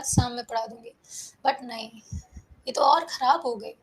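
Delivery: ordinary talk, nothing marked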